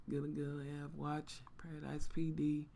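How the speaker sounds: whispered read-through; reading quietly. Real, not acted. Host reading a viewer comment to herself, whispered. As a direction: murmur it to yourself. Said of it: "reading chat quietly".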